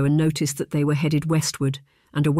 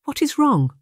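much higher, worried question; concerned; 11 semitones higher than usual. A narrator's reading. Charlotte hears Colin gasp, asks in concern, much higher. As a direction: ask gently what is the matter.

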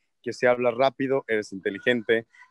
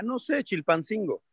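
slow, higher clueless answer; playing dumb; 6 semitones higher, 4 dB quieter than usual. Answering a quiz question, playing the fool on purpose. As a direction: act like a fool who doesn't know. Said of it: "¿Cuál es la capital de Oaxaca?".

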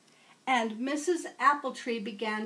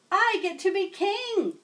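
much higher, louder eager shout; children shouting; 9 semitones higher, 5 dB louder than usual. Acted. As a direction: shout it like excited kids. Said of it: "everyone shouted".

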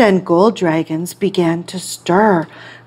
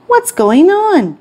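puzzled onlooker; 9 semitones higher than usual. Acted. Crowd puzzled by eyeless dragons.

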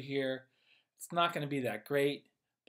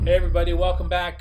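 loud greeting; 3 semitones higher, 12 dB louder, much faster than usual. Real, not acted. Energetic show opening.

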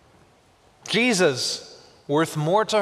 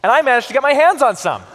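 mock excited boast; 7 semitones higher, 7 dB louder, much faster than usual. Mock boast about the cologne.

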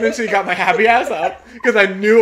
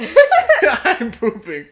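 higher, louder, slowly stretched through laughter; laughing repeat; 4 semitones higher, 6 dB louder, much slower than usual. Acted. Repeating the tweet that got her, laughing. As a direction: draw it out while cracking up.

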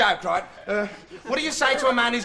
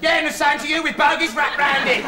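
loud, higher-pitched irritated rant; indignant complaint; 6 semitones higher, 4 dB louder than usual. Acted. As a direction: tell off the studio crew, loud and put out. Ranting at studio crew over moved cameras.

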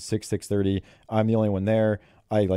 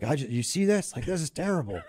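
higher, softer mock complaint about a bad swing; imitating frustrated coach; 7 semitones higher, 4 dB quieter than usual. Coach showing his bad swing to new golf coach, mock grumble. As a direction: lightly play the coach grumbling at himself.

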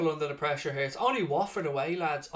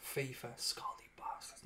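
muttered bitter whisper; whispered curse; 5 semitones lower, 18 dB quieter than usual. Real, not acted. Gamer cursing FIFA under his breath, whispering. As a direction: breathe the insult.

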